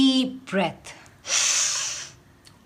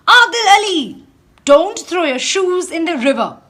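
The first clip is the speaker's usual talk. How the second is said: loud, high, fast angry yell; angry shout; 5 semitones higher, 7 dB louder, faster than usual. A narrator's reading. Fisherman Joseph scolding Adil about his shoes, loud angry shout. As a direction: bark the order at Adil. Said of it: "shouted Joseph".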